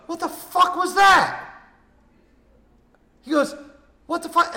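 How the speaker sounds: high, loud disbelieving yell; shocked shout. Retold or imitated. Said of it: "And he goes".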